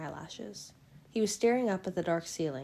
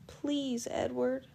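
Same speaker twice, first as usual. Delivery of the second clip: tearful plea; 8 semitones higher, much slower than usual. A narrator's reading. Bella begging Edward, high tearful plea. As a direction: plead with him through tears. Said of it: "my plea muffled against his skin".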